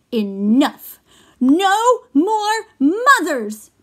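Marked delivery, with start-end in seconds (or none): 1.4-3.7 high, loud, slow crying shout; loud high fed-up wail. Acted. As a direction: yell it out fed up. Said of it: "he shouted".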